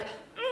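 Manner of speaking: soft smacking kiss sound; quick kiss noise. Shown as a demonstration. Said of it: "Do a kiss noise".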